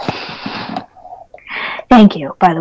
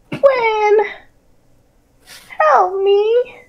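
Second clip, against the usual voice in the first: high, soft, distant pleading voice; ghostly nightmare voice; 11 semitones higher, 6 dB quieter than usual. Acted. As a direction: call out from the dark like a lost spirit. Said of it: "voices echo in his nightmares".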